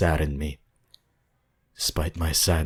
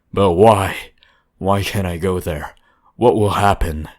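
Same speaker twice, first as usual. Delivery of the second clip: loud, higher demanding outburst; demanding, almost angry; 3 semitones higher, 9 dB louder than usual. A narrator's reading. Traveller demands answers from the old man, loud and angry. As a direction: push back hard, near shouting at him. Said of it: "in a demanding, almost angry tone".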